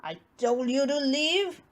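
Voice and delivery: lightly voiced bear ordering mouse out; a hint of a gruff bear shouting; Bear crying out. A narrator's reading. Bear ordering the mouse out of his house, mild cry. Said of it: "cried Bear".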